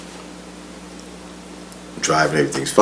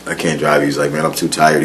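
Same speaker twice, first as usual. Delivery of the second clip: tired quote; 6 semitones lower, 8 dB quieter than usual. Retold or imitated. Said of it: "he was like".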